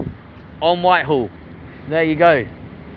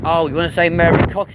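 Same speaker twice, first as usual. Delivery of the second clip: normal talking voice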